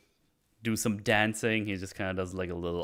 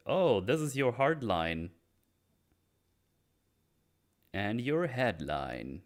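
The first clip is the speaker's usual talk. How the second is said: lightly voiced, slow drawn-out fortune teller; palm reader; slower than usual. Palm reader reading his hand lines, lightly intoned. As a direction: draw out each finding with lingering interest.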